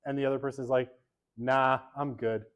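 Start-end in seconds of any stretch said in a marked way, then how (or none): 1.4-2.5 slow, offhand "nah, I'm good"; casual brush-off. Pretend. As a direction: drawl a lazy refusal. Acting a date's brush-off.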